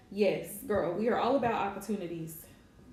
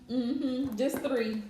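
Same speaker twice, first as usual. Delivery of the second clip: ordinary delivery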